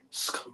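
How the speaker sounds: sk- whispered as a bare consonant cluster; isolating sk for a child; sounding out sk. Shown as a demonstration. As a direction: teach the sk sound on its own, very softly. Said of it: "You're gonna say".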